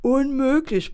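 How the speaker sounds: empört und höher, etwas lauter; entrüstet widersprechen; protestierend. Acted. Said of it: "protestierte der Pförtner". (Pförtner protestiert empört gegen den Werkleiter)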